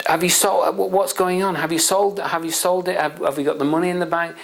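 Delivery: high, urgent questioning in imitation; panicking father. Father asks son about the sale, acted panic. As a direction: act out frantic questions tumbling out. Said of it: "the father's panicking and panicking and panicking".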